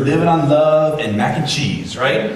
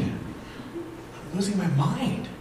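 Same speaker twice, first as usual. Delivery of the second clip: worn-out murmur; 4 semitones higher, 10 dB quieter, much slower than usual. Retold or imitated. Exhausted new father's inner cry.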